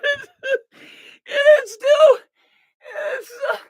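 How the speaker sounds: high-pitched, breaking up with real laughter; helpless laughter. Real, not acted. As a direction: laugh so hard you nearly cry and can't finish. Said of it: "if I don't laugh, I'm gonna cry".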